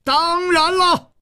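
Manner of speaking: very high, drawn-out yell; strained full-out roar; roaring back. A narrator's reading. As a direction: bellow it slowly in defiance. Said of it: "李玉涵也大吼着".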